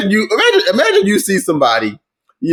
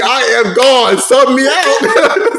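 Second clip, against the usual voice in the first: slow, higher voice of a beaten player, giving up; defeated player quitting; 7 semitones higher, much slower than usual. Retold or imitated. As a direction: plead to be subbed out, half laughing.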